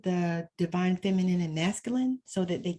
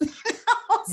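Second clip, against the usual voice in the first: high short laugh at her own joke; quick high real laugh; amused laugh. Real, not acted. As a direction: chuckle at the punchline.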